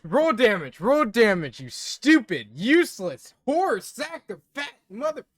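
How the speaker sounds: loud, hammed-up yelling at the players; mock furious rant. Game master mock-raging at his players, loud. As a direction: blow up at the table in fake rage.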